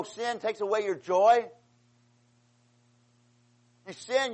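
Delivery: normal talking voice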